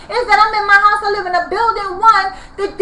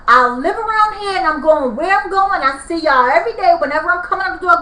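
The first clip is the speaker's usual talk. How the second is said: normal talking voice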